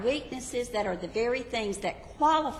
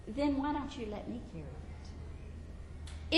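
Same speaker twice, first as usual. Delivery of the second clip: soft, slow, tender offer; Jesus tenderly offering to carry the burden; 4 semitones higher, 8 dB quieter, slower than usual. Acted. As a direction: offer gently, much softer.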